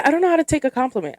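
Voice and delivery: ordinary talk, nothing marked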